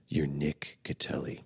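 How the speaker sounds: hushed, slow, low affirmation; near-whisper it slowly, like a hypnotist; hypnotherapy affirmation. Acted. Hypnotherapy video telling himself who he is, hushed affirmation. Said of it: "like, a hypnotherapy".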